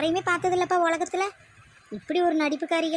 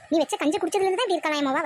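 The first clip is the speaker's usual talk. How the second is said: ordinary delivery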